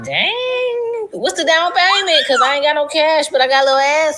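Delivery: cheeky sass about having no cash; a touch of hammed-up flirting; flirty inner thought. Her own thoughts on seeing salesman.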